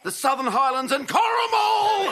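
high, loud showbiz announcing; announcer roll call. Acted. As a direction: call out each name like a game show host. Host announces panel and audience.

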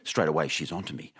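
ordinary delivery

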